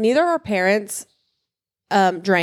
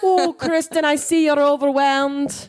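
higher, slow mock-gallant Irish accent; 7 semitones higher than usual. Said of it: "please don't come in with that accent".

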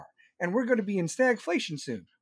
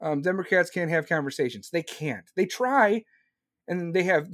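ordinary talk

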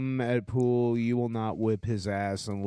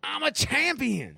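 way higher, squeaky mock boast; mock high squeal; 14 semitones higher than usual. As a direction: squeal it like a silly cartoon champ. Mocking high champion chant.

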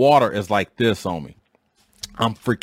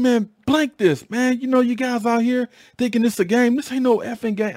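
high-pitched, worked-up retold rant; going off; 14 semitones higher than usual. Sergeant going off at his boat team, high-pitched retold anger. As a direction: re-enact losing your temper, pitched way up. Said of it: "I'm just going off".

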